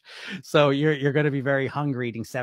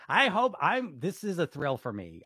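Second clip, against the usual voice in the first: ordinary talk, nothing marked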